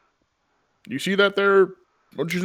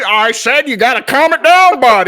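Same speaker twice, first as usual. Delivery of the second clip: higher, louder exasperated scold; 7 semitones higher, 8 dB louder than usual. Acted. Hunter losing patience with his yak, louder scold. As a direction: raise your voice at the yak.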